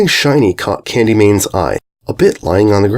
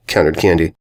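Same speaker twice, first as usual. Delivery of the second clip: plain reading voice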